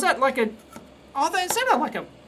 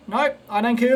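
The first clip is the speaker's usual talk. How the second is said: normal talking voice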